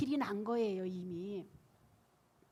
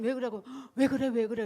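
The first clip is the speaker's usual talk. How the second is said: louder, fast, laughing why-are-you-like-this; mock exasperated; 10 dB louder, faster than usual. Wife laughing loudly at her chanting husband. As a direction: toss it off fast and loud through a laugh. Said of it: "그랬더니".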